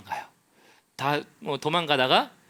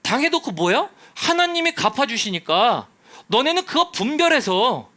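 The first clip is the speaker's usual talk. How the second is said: higher, louder impassioned sermon; full-force preaching; 8 semitones higher, 7 dB louder than usual. Acted. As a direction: preach it hard to the congregation. (높고 큰 목소리로 열정적인 설교)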